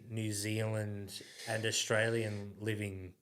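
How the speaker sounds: ordinary delivery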